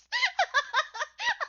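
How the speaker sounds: loud squealing laugh; real laughter up very high; can't hold back the laughter. Real, not acted. Cracking up retelling story.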